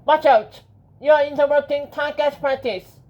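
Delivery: high, squeaky little creature. A narrator's reading. Tiny mouse warning someone off target practice, squeaky voice. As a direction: pipe up loudly in a tiny squeak. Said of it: "squeaky voice said".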